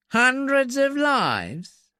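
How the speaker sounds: high, slow, incredulous mocking question; sneering echo. A narrator's reading. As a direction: throw it back with a sneer. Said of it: "Briggs sneered".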